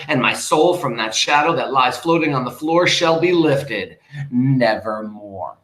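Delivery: dramatic poem reading. Acted. Teacher reading Poe to the class, declaiming. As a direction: read the lines like a stage poet.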